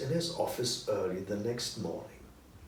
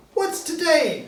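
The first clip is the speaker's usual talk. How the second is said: high, loud call down to the street; excited high shout out a window; shouted question; 10 dB louder, slower than usual. Acted. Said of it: "Scrooge shouted to a boy".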